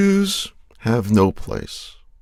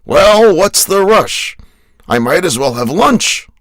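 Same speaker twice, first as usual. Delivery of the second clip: loud, higher-pitched character protest; acted deathbed quip; 9 semitones higher, 9 dB louder than usual. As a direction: pipe up loudly, in no rush to die.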